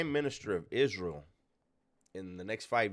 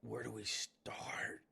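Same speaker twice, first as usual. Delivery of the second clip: slow whispered disbelief; 9 dB quieter, much slower than usual. Real, not acted. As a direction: breathe it out slowly. Stunned hushed reaction.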